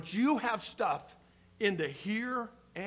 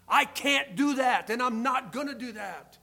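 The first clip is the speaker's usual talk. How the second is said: loud, emphatic acted refusal; firm refusal; 4 semitones higher, 6 dB louder than usual. Young believer turning down friends' night out, firmly. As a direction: push it out high and strong, standing your ground.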